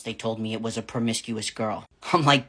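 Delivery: usual speaking voice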